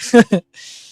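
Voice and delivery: sudden high, loud laugh; a big real laugh cracking up at the joke; loud burst of laughter. Real, not acted.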